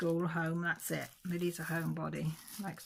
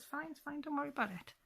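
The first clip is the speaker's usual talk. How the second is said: higher, softer soothing reassurance; calm her down gently, pitched up; reassuring; 9 semitones higher, 3 dB quieter than usual. Retold or imitated. Mother soothing frightened daughter before blood test, higher and soft.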